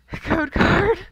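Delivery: high, loud mock wail of dismay. Real, not acted. Pack opener wailing at yet another code card. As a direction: wail it like a pained joke. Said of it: "I cry".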